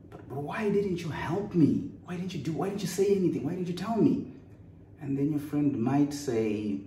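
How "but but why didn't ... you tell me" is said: frantic pleading. Pretend. Snake-bite victim begging the friend who saw it, high frantic pleading. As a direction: plead in a pretend panic with your friend. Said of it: "you're screaming, you're frantic".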